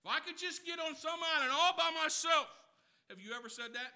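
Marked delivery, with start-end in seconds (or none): 0.0-2.5 higher and louder, yearning to flee; an exasperated wish to escape everything; wishful escape. Retold or imitated. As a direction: cry out to get away to an island. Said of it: "This is what you say".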